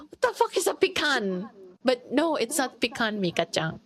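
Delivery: normal talking voice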